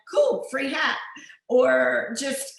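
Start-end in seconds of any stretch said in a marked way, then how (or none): 0.1-1.0 higher, louder breezy "cool"; carefree delight. Retold or imitated. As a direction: shrug it off happily like the easygoing brother. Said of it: "probably would have said".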